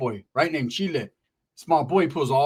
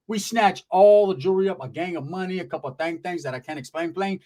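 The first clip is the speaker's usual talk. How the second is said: usual speaking voice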